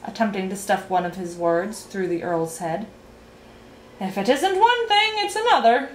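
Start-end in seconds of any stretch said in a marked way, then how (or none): 4.0-5.9 high, fussy telling-off; chiding. A narrator's reading. Minister at the banquet chiding the earl, high and fussy. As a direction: chide him, pitched right up. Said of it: "chided the minister".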